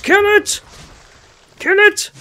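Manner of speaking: high, loud yelps to kill the bug; panicked shout. Real, not acted. Gamer yelling at a bug to kill it, high panicked shout. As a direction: shriek it at the screen.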